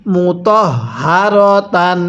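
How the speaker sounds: slow Quran reading, syllable by syllable; measured teaching recitation; model recitation. Shown as a demonstration. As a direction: draw it out for students to repeat. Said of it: "এরপরে পড়ুন".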